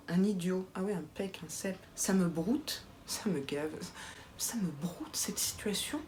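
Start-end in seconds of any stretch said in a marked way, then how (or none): 3.1-6.0 whispered afterthought; sly whispered aside. Real, not acted. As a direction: breathe it out as a whisper.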